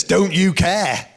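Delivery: acted indignant protest, slowed down; rude reproach. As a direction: throw the disciples' complaint out in disbelief. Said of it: "I mean, imagine that, saying to Jesus".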